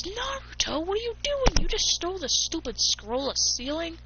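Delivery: high-pitched, incredulous telling-off; exasperated scolding. Acted. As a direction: pitch leaps up as you demand an explanation.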